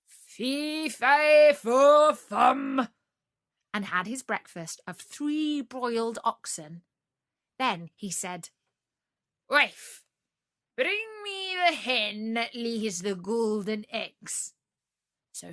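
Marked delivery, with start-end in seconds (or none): 0.1-2.9 booming giant voice, much higher and louder; thundering ogre chant; ogre roar. Acted. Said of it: "far shout". 9.5-14.5 ogre giving orders. Acted. Ogre voice to wife.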